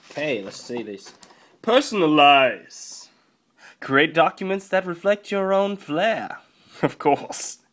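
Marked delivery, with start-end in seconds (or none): 1.6-6.4 hammed-up product pitch, raised pitch; put-on advert voice. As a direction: read the box like a mock commercial. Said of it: "I like this voice now".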